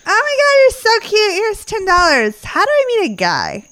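very high gushing voice, slowing on the money line; gushing drunk fans. Retold or imitated. Retelling drunk Cubs fans gushing over her advice stand, very high. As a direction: coo at her like a tipsy fan. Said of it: "people would be like".

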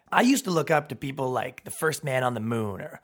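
usual speaking voice

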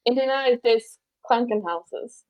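put-on careful German accent, word by word. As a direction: say the German phrase the way it was practiced. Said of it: "I can't do the German accent".

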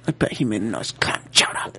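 low whisper; whispered joke. Acted. Whispering a dark joke to a friend. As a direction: hush it, mock sinister.

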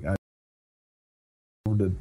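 ordinary talk

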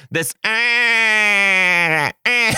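loud high bleating eh; loud mock calf bleat; baby cow bleat. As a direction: bleat it like a calf being pulled. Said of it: "You sound like a baby cow".

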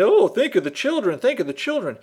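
high, whiny mock alarm; mocking pearl-clutcher. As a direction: camp it up like a fretting busybody. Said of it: "some people say".

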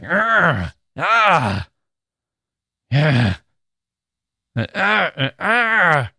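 hammed-up moans of agony and lust; lustful anguished grunts. Shown as a demonstration. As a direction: cry out in raw frustrated desire. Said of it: "expect to hear something more like".

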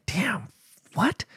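high, hushed disbelief; retold disbelief. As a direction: react softly, pitched right up, in stunned disbelief. Reacting to son's confession.

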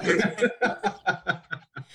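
high, breathless peals of laughter; helpless laughter. Real, not acted. Podcast guest cracking up at the impression, helpless laughing. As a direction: keep laughing, unable to stop. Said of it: "Arena's lost it".

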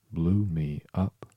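slow, mildly winded queen; panting. A narrator's reading. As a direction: draw it out as if a little short of breath. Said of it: "panted the queen".

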